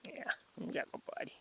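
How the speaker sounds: soft whisper to calm the cat; whispered soothing. Real, not acted. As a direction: hush it to the kitty.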